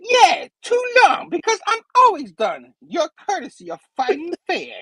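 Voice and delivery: high-pitched, wisecracking superhero retort; Spidey. Retold or imitated. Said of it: "And he's like".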